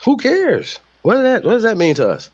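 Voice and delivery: high mock voice asking who cares. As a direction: pitch way up to mock a careless attitude. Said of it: "I'd be like".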